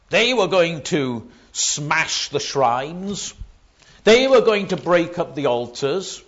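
loud attack on each sentence start; forceful preaching. Acted. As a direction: preach it in hard, separated blows.

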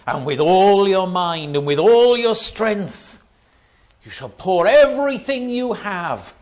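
loud, high, building sermon delivery; impassioned pulpit proclamation; preaching at full force. Acted. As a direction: preach it rising, hammering each phrase.

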